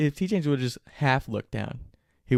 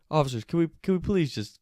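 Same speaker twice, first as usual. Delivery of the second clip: high-pitched imitation of begging; pleading; 5 semitones higher than usual. Griff slides a foot out toward police, pleading. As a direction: plead with the officers, voice lifted. Friend begs police to talk.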